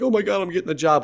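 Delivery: high, rushed mom impression; quick, high-pitched mock outburst; imitating worked-up mom. Retold mom's alarmed announcement.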